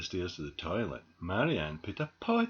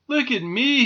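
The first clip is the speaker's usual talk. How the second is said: high, loud show-off call; excited shout; 13 semitones higher, 9 dB louder than usual. A narrator's reading. Squirrel shows off on the washing line, excited shout. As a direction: call out loudly, showing off. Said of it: "he shouted".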